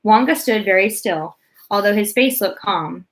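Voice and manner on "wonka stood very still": usual speaking voice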